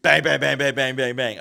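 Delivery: rapid-fire loud bangs, falling off; loud, high burst, tapering away; imitated door pounding.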